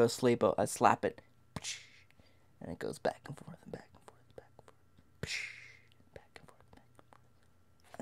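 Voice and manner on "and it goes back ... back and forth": whispered repetition dying away; fading whisper. Retold or imitated. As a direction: trail down into a barely audible whisper. Fading close on swinging hand.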